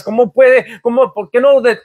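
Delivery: normal talking voice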